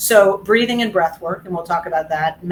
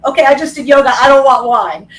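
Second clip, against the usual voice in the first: higher, louder hammed-up announcement; mock resolve; 6 semitones higher, 8 dB louder than usual. Someone fresh from yoga swearing off wine, mock resolve. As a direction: declare it like an instant cure, tongue in cheek.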